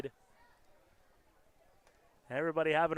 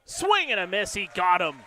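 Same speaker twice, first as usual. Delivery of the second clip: louder, rising strikeout call; excited call; 8 dB louder than usual. Real, not acted. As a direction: sell the big strikeout.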